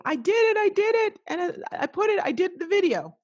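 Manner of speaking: high, loud, jubilant outburst; excited triumph. Retold or imitated. Retells client's jubilant message.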